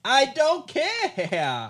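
very slow, high, loud shout. Acted. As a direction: bellow it in a rage. Skit gangster shouting.

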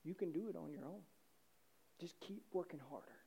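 very soft, insinuating murmur; hushed tempter, spaced-out phrases; imitated whisper. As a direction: coax quietly into someone's ear. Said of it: "will whisper in your ear".

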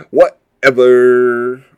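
slow, drawn-out "Whatever"; dismissive valley girl. Retold or imitated. Podcaster doing the Clueless brush-off, slow and dismissive.

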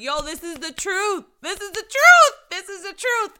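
building to a loud, high, forceful declaration; she's proclaiming to her viewers the truth the church avoids, forceful; emphatic insistence. Real, not acted.

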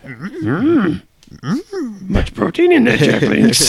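high, squeezed little Yoda voice. Pretend. Friend doing Yoda about jerky links, high and squeezed. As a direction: croak it like a tiny Jedi master.

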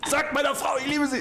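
high, gasping dying words; overacted death scene. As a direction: ham up a last farewell. Dying man's last words.

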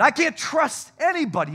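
high, loud cry of distrust; bitter outburst. Pretend. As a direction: spit it out in resentment. Voicing resentful thoughts.

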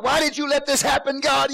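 high-pitched acted protest to God; frustrated with God. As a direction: cry out as if demanding answers from God. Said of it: "You're gonna get frustrated".

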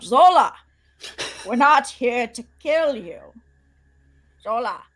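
calling out. Pretend. Player calling to Zola that they come in peace, calling out. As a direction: call out to someone far off. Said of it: "we should just call it out".

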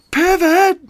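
high, loud yells with gaps between; Ross. Acted. Ross yelling while carrying a couch upstairs, high and loud. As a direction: keep yelling the same command. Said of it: "Ross keeps yelling".

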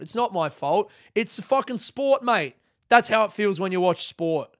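loud, high, indignant protest; worked-up comic rant; exasperated outburst. Acted. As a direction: protest your innocence, voice shooting up.

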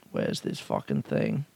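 real under-the-breath mutter; muttered aside. Real, not acted. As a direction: mumble while hunting for something. Searching for the email.

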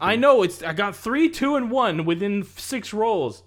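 high, indignant complaint about luck; worked-up protest; exasperated protest. Real, not acted. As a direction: argue the dice are against you. Player protests his rolls.

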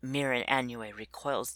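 ordinary delivery

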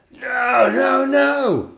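very high, loud scared shriek; panicked shriek. Acted. Witch fleeing the princess's breath, shrieking in panic. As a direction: scream in terror. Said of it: "shrieked the witch dragon".

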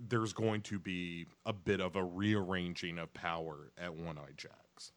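usual speaking voice

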